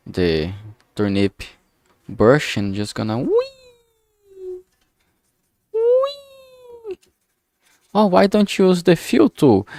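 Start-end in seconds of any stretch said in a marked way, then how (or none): 3.2-7.0 very high drawn-out wee; playful wee. Pretend. Digital artist swiping the brush across the base, playful wee. 7.9-9.7 high, loud mock question; imagined questioner. Artist mimicking a viewer asking about the fill tool, mock questioning.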